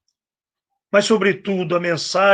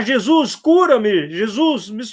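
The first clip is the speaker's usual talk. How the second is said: higher-pitched mild mock yell; imitated crying out; 6 semitones higher than usual. Blind man begging Jesus to heal him, retold mild yell. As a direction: call out pleadingly, without full shouting. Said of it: "Aí começou a gritar".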